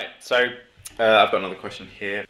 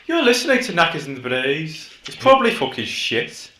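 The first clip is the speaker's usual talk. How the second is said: raised-pitch Midlands presenter parody; put-on Midlands accent; 6 semitones higher than usual. Host introducing own podcast, put-on Midlands accent. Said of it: "Can you do the whole podcast in that accent?".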